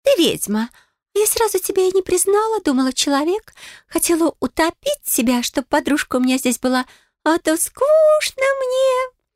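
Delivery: high, matter-of-fact nymph voice; a bored mermaid stating it plainly; blunt mermaid voice. Acted. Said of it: "произнесла без церемоний".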